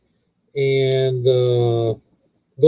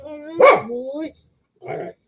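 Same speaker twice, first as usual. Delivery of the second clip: much higher, sing-song cooing at the dog; 12 semitones higher, 4 dB quieter than usual. Real, not acted. Owner coos at dog.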